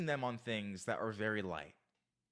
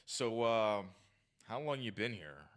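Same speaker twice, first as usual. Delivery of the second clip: tense, standoffish; 3 semitones lower than usual. Shown as a demonstration. Bad example of approaching a woman, tense and standoffish. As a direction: demonstrate a stiff, stand-offish approach. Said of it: "with tense body language and, and kinda stand off".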